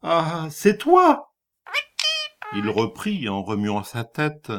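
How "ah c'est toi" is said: plain reading voice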